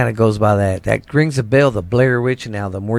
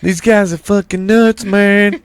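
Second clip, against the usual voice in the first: higher-pitched mock disbelief; mock outburst; 8 semitones higher, 4 dB louder than usual. Acting out what neighbors would say, in mock disbelief. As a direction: act out an onlooker calling them crazy. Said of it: "didn't wanna sound crazy and be like".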